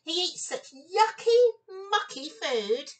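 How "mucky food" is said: ordinary delivery, nothing marked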